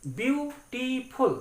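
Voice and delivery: ब्यूटीफुल said slowly as ब्यू-टी-फुल; teacher spelling out ब्यूटीफुल by syllables; split into syllables. Shown as a demonstration. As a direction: break ब्यूटीफुल into three beats, slowly. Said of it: "टुकड़ों में करते हैं".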